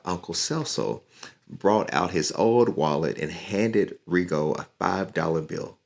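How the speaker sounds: plain reading voice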